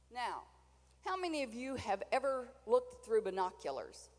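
ordinary talk

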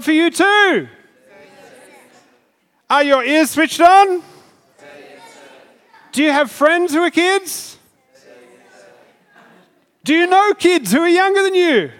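loud, high drill sergeant bark. Acted. Sergeant drilling the standing kids.

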